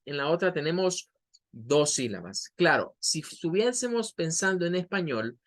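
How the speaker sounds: ordinary talk, nothing marked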